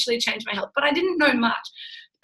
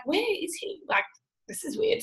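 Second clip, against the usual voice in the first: softer, higher, puzzled to herself; puzzled murmur; 7 semitones higher, 8 dB quieter than usual. Retold or imitated. Wife wondering where her quiet husband went, puzzled murmur. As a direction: wonder aloud under your breath.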